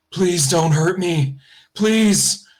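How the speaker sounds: a trapped man begging, drawn out; pained plea. A narrator's reading. Face in the jelly begs the player, slow pained plea. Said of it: "looks pained almost".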